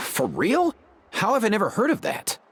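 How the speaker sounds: high-pitched astonished question; can't believe nobody told you; amazed disbelief. Acted. Hearing about the forgotten trials for the first time, amazed.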